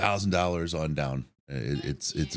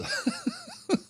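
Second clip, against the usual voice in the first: high-pitched laugh; 4 dB quieter than usual. Real, not acted. Laughing at his made-up word.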